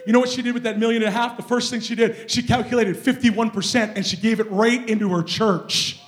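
loud, triumphant testimony; forceful preaching. Real, not acted. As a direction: proclaim it to the whole church.

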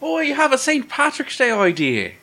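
high put-on Irish lilt; playful mock Irish accent. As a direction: lay on a jokey Irish brogue. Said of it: "Interesting Irish accent, that is".

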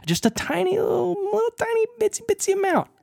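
very high, small voice; tiny squeaky voice. Shown as a demonstration. Telling a friend whiskey takes tiny sips, tiny high voice. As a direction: shrink it up high for a tiny amount. Mimes sipping tiny amounts.

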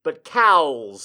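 normal talking voice

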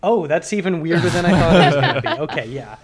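loud amused laughter through words; laughing. Real, not acted. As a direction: laugh while you talk. Reacting to weird hawk photo.

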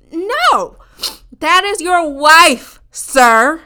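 slow, loud, pointed correction; loud scolding. Retold or imitated. Correcting the married man to his face, loud and slow. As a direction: lay it down slowly and firmly.

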